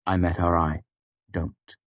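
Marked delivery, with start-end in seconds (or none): 1.3-1.8 grim. A narrator's reading. Narrator warning Devi away from the drawer, grimly. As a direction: warn her off quietly. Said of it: "I said grimly".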